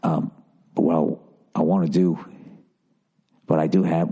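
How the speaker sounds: low-pitched put-on British voice; halting, deep British old-man impersonation; bad British accent. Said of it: "I can't keep doing this accent".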